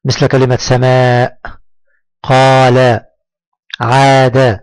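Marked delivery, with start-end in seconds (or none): 0.6-1.4 سماء with the final aa held long; long alif madd. Shown as a demonstration. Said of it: "مدا بالألف". 2.2-4.6 قال، عاد with a long-held aa; long alif madd. Shown as a demonstration. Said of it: "مدا بالألف".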